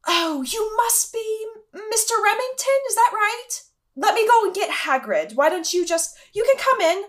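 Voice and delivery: high, airy, flustered professor; Professor Lovegood. Acted. Professor greeting an unexpected student at the door, high and flustered. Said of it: "I'm Professor Lovegood".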